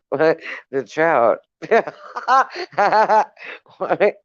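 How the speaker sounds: usual speaking voice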